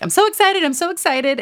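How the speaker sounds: squealing I'm so excited, then settling; mock excited. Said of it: "she's walking around like".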